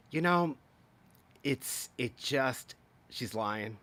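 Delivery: usual speaking voice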